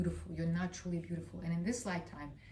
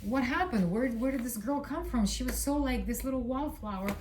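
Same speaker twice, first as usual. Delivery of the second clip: higher, mock-startled reaction; raised pitch, retold bewilderment; imitated astonished onlookers; 4 semitones higher, 3 dB louder than usual. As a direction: act out people taken aback. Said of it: "people would be like".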